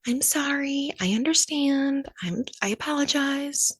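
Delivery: high, polite scripted apology; mock the rep's canned sorry; customer service spiel. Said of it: "the usual spiel".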